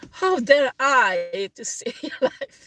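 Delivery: real laugh breaking into the words; slow start, dissolving into laughter. Real, not acted. Mocking those who wanted her dead, laughing. Laughs at being still alive.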